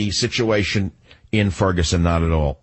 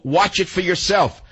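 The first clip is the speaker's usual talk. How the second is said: usual speaking voice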